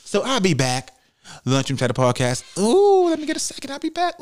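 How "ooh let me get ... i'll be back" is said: high squeaky urgency. Pretend. Rushing off to bathroom.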